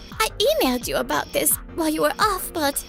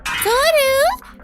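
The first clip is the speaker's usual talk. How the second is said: urgent call; 6 semitones higher, 5 dB louder than usual. Acted. Alerting colleague to urgent problem.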